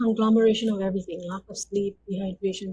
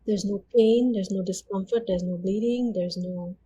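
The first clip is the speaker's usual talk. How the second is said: ordinary delivery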